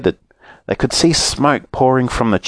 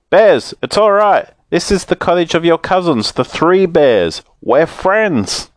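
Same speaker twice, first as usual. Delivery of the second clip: calling out; 9 semitones higher than usual. Acted. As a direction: yell it out to the bears. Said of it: "yelled out of it".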